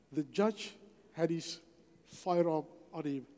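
normal talking voice

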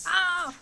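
sharp startled cry; startled yelp. Real, not acted. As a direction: yelp in surprise. Dog punches her belly.

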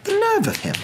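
high-pitched indignant outrage; enraged. A narrator's reading. Narrator voicing Mister Dursley's thoughts about a cloaked older man, enraged. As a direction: build from indignant disbelief to a high, scandalised exclamation. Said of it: "Mister Dursley was enraged".

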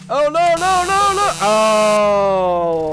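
loud, very high panicked wail; rapid string of cries into one long wail; panicked yell. Real, not acted. Player losing badly in the game, panicked.

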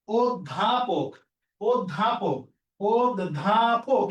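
অধ্যাপক slowly as od-dha-pok; o-sound pronunciation. Shown as a demonstration. Teacher modelling the word for students, slow syllables. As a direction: break অধ্যাপক into drawn-out syllables, opening on round o. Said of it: "অ এর উচ্চারণটা ও এর মতো হবে".

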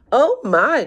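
high, loud, flattered exclamation; delighted cry. A narrator's reading. Old woman is offered the crown, crying out flattered. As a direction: cry out high in pleased surprise. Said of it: "cried the tiny old woman".

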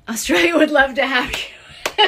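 high, loud, laughing read-out; laughing while reading. Real, not acted. Reads invitation, amused by it.

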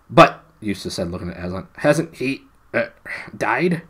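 high, loud nervous question; anxious Eustace. A narrator's reading. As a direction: blurt out the fear.

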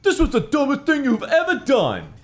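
very high, put-on outraged voice; hammed-up high reproach; exasperated scolding. A narrator's reading. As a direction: squawk the scolding indignantly. Scolding the invisible brother.

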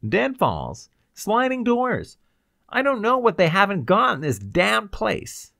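high, loud, agitated answer; frantic warning. Acted. Ryder tells Cloud about the traps, frantic. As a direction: spill out the dangers in alarm.

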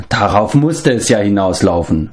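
normal talking voice

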